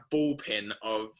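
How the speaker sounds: normal talking voice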